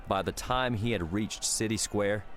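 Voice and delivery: ordinary delivery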